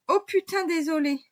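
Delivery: éclat aigu et fort, nerveux; s'excuser d'avoir ri, affolée; rire nerveux. A narrator's reading. Maddy après un rire nerveux. (Maddy s'excuse, aiguë et forte)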